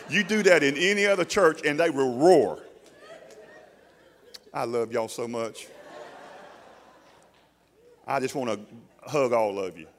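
ordinary talk, nothing marked

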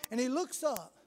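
ordinary talk, nothing marked

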